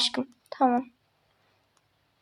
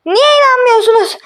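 indignant insisting; 8 semitones higher than usual. Acted. Insisting nobody believes her.

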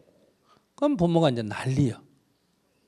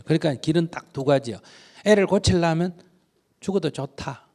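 usual speaking voice

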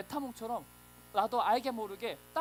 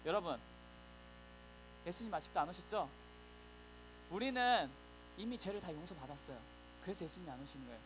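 softer, lower, coaxing liar; sly liar; 4 semitones lower, 8 dB quieter than usual. Acted. A liar tempting the wavering church, soft sly coaxing. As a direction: lure them in quietly like a con man. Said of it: "어떤 거짓말쟁이가 찾아오는 거예요".

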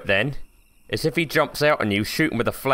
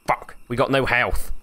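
ordinary talk, nothing marked

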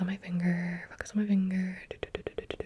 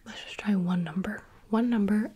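ordinary talk

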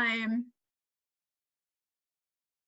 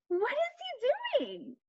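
soft wondering question; 11 semitones higher, 8 dB quieter, slower than usual. Acted. Asking kids about picture.

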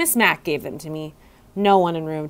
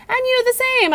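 much higher little squeak; hamster squeak; 3 dB louder than usual. A narrator's reading. Hamster answering the custodian, high squeak. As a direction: squeak it back brightly. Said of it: "I squeaked back".

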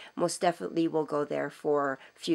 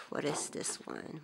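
whispered aside; 2 semitones lower, 11 dB quieter than usual. Real, not acted. Muttering to herself checking label.

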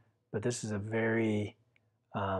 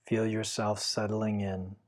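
guided meditation. Acted. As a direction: guide the listener gently into stillness. Leads a Yoga Nidra meditation.

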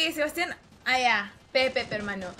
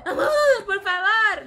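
slow whiny plea, higher and louder, half laughing; mock girlfriend pleading; 6 semitones higher, 5 dB louder than usual. Streamer imitating a girlfriend begging her partner, mock whiny plea. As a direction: beg like a girlfriend, hammed up through a laugh.

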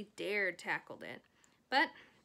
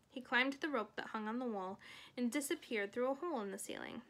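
ordinary delivery, nothing marked

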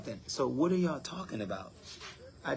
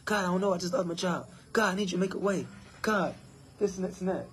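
higher whiny pleading to God; mock worried prayer, pitched up; mock anxious complainer; 6 semitones higher, faster than usual. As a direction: imitate a fretting believer begging God for money. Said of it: "y'all just saying".

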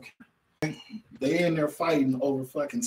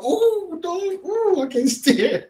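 much higher squealing mock complaint; mock frustrated boy; 6 dB louder, slower than usual. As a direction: ham up an angry kid who can't take it. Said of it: "he like".

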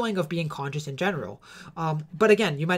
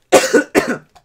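coughing fit; 8 semitones higher, 13 dB louder than usual. Real, not acted. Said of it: "I'm coughing so much".

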